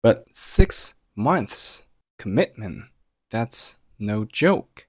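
"six months commitment" drawn out word by word, very slowly; slow right down and stress each key word in "that's no joke"; slow emphatic stress. Shown as a demonstration. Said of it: "标黄的单词都做了重读".

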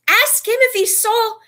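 shaken. A narrator's reading. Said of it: "pointing a shaking finger".

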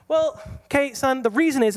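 high, loud-ish self-justifying parent; defensive excuse. Acted. Acted excuse to son.

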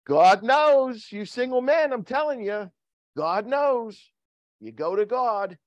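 high, ringing exhortation; emphatic preaching. Real, not acted. Preacher telling single men God knows, high emphatic exhortation. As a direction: proclaim it to the room, pitched high.

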